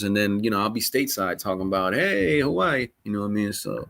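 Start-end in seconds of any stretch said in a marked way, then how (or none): 1.9-2.9 high, drawn-out "heyyy" greeting; mock vacation holler. Host pretending to post from vacation while stateside, mock holler. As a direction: playfully sing out the greeting, stretched long. Mimics own future post bragging.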